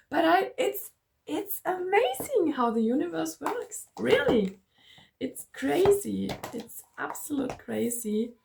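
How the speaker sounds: high, giddy wonder after a laugh; amazed excitement. Real, not acted. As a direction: gush in amazement, pitch lifted. Marvelling at how the universe works.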